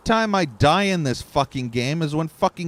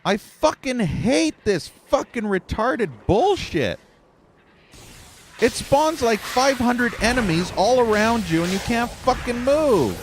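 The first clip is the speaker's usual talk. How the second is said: higher, heated ranting; angry rant; 9 semitones higher than usual. Real, not acted. Streamer cursing the studio over its game, heated rant. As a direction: vent it all at the developers.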